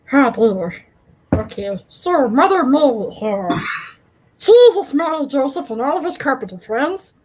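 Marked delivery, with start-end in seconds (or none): 1.9-7.1 mock Irish brogue with a creepy Gollum edge; Irish Gollum accent. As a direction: ham up a drunken Irish Gollum. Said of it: "Irish Gollum accent".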